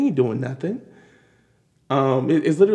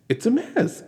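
it's a mess, pitched higher with a rising end; an incredulous, higher it's a mess; exclaimed; 8 semitones higher, 3 dB quieter, slower than usual. Acted. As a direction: toss it's a mess up high in disbelief.

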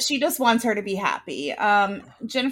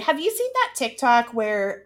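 ordinary delivery

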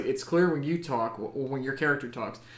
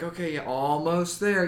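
playing pilot quote; 4 semitones higher than usual. Kid playing X-wing pilot.

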